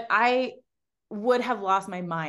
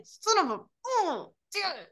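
retold exasperation; 11 semitones higher than usual. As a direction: groan out the curse in mock dismay, pitched up. Retold reaction to being flagged down.

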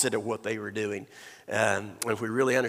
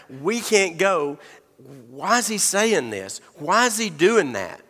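slow, higher, puzzled questions; bewildered disciples; 5 semitones higher, 3 dB louder, slower than usual. Acted. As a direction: drag out the confused questions. Voicing disciples' confusion.

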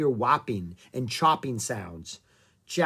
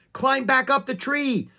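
high, loud urgent command; shouted order; 12 semitones higher, 6 dB louder than usual. A narrator's reading. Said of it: "Jack shouted at Annie".

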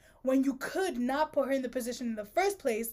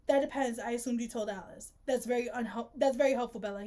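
ordinary talk, nothing marked

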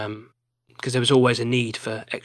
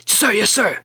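barked military "Sir, yes, sir", higher and louder; loud gung-ho soldier; 9 semitones higher, 4 dB louder than usual. Retold or imitated. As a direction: snap it out like an eager American soldier. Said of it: "they are very over the top a little bit".

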